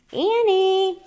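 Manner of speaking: high, loud yell of her name. A narrator's reading. Jack yelling for his frightened sister, high and loud. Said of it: "he yelled".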